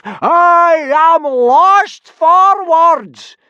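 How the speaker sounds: high, loud, slow furious tirade; Gobber howling. A narrator's reading. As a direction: bellow insults, building to a drawn-out howl. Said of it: "howled Gobber".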